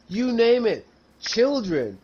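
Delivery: normal talking voice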